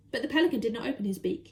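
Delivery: usual speaking voice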